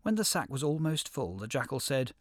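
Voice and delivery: usual speaking voice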